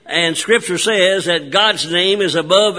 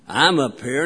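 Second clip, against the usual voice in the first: low, softer voice from above; 5 semitones lower, 5 dB quieter, slower than usual. Retold or imitated. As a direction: play the Lord answering in a lowered voice. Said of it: "And a low voice said".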